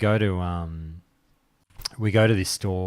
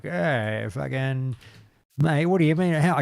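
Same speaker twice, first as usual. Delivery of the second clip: cheeky chatty; 7 semitones higher than usual. Retold or imitated. Cheeky chatty customer retold.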